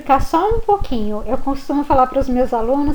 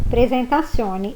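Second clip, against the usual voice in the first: ordinary talk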